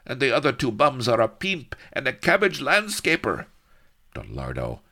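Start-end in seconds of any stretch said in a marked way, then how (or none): 0.0-3.5 gruff soldier. Acted. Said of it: "announced Corporal Gomez".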